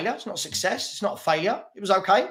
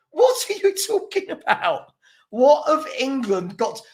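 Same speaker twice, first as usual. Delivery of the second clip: incredulous high squeak; 6 semitones higher than usual. Real, not acted. At the commenter, a high disbelieving squeal. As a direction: pitch shoots way up in disbelief.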